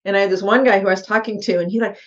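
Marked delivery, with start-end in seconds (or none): none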